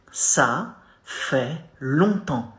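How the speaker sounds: Ça fait longtemps drawn out slowly, each syllable separated; slow, careful teaching model; slow demo. Shown as a demonstration. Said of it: "You say".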